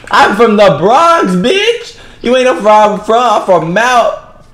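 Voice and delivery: high, loud put-on Bronx voice; mock Bronx trash talk. Gamer taunting an opponent in a high mock Bronx voice. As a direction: squeal the insults like a tough Bronx girl.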